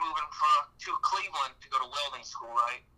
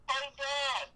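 put-on high, childish call over the walkie-talkie; imitating his son calling; 10 semitones higher than usual. Four-year-old son calling dad over walkie-talkie, high mock kid call. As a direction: pitch way up like a small kid yelling for dad.

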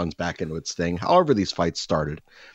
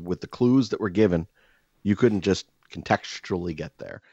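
usual speaking voice

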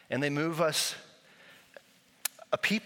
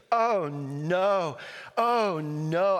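put-on higher, louder child's distressed oh no; little boy's loud oh no; toddler wailing oh no; 6 semitones higher, 4 dB louder, much slower than usual. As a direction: imitate the boy crying out from the other room. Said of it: "he's like".

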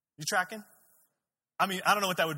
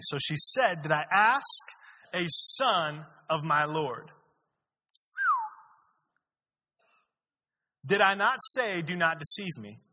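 loud, higher-pitched Bible reading; forceful scripture reading; 4 semitones higher, 9 dB louder, slower than usual. A narrator's reading. Preacher reading the story to a congregation, forceful scripture reading. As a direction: read the passage out to the whole room.

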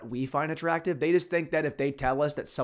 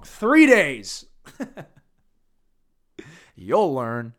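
mock jailer; 9 dB louder, much slower than usual. Playing the cop jailer.

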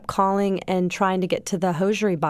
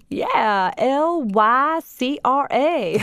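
put-on slow, higher Southern accent spelling each letter out; 6 semitones higher, much slower than usual. Ted spelling the fiber for Sarah, slow Southern accent. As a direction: mimic Ted's Southern accent, spelling it slowly. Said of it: "the most thick Southern accent".

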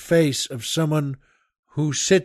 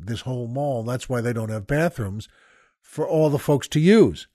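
normal talking voice

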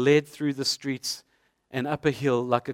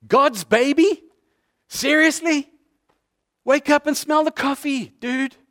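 incredulous friends scoffing at Joseph; mocking mates; 9 semitones higher than usual. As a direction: needle him like a disbelieving mate. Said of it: "can you imagine his friends, his mates?".

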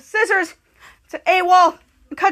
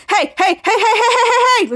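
rapid heys climbing higher; mock panicked protest; 6 semitones higher, 3 dB louder than usual. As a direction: protest in rising mock alarm. Lifted character protests while hovering.

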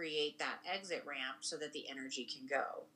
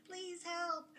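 pleading spine; 13 semitones higher, 4 dB quieter than usual. Retold or imitated. Spine voiced as a pleading body part.

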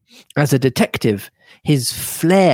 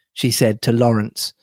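ordinary delivery

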